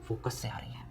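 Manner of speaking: breathy prompt, no voice at all; whispering focus to the students; whispered answer. Shown as a demonstration. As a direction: whisper the answer as a hint to the class. Teacher answers own question softly.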